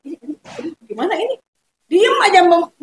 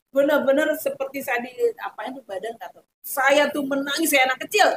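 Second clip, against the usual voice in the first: normal talking voice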